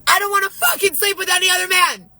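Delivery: blurting it out, furious and fed up; angry outburst. Real, not acted. Woman venting about her husband's demands, shouting high and fast in real anger.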